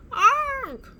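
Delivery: very high, loud shriek of fright; a startled shriek; scream. A narrator's reading. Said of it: "screamed Nina".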